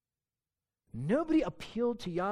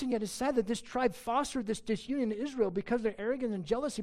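usual speaking voice

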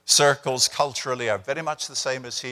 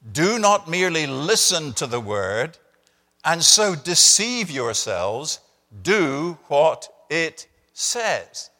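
slow, louder, weighty reading of the verse; solemn scripture reading; 3 semitones higher, 4 dB louder, slower than usual. Acted. Preacher reading the key verse to the congregation, slow and weighty. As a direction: lay each word down slowly like scripture.

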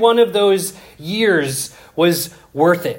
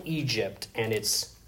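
dramatic hush; 7 semitones lower, 14 dB quieter than usual. Acted. Dramatic reveal of Joseph.

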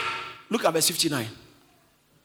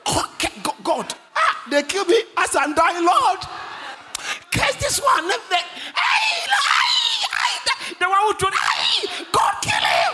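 high, hammed-up shouted curses; comic dying man calling down vengeance; mock dying curse; 14 semitones higher, 6 dB louder, slower than usual. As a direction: cry out wildly between broken phrases. Acts dying man cursing his killers.

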